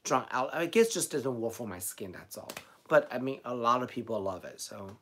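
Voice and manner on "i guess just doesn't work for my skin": usual speaking voice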